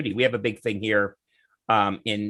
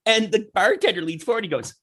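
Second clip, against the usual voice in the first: ordinary talk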